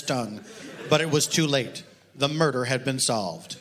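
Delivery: deadpan reading of a mock detective novel; story reading. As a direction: read it dry, like a serious audiobook. Author reads novel aloud.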